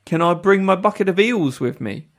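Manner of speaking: eager British fishmonger; put-on British accent. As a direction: ask in a hammy Renaissance-fair British accent. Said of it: "to be a fishmonger in a Renaissance fair".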